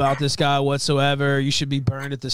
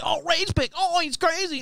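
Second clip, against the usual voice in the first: high mocking voice; mocking whine; 13 semitones higher than usual. Critic quoted mockingly, high mocking voice. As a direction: sneer it back like a whiny critic. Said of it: "it's like".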